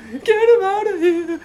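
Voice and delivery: retold crying shriek. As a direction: wail it like the bleeding woman begging. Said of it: "she was crying, going".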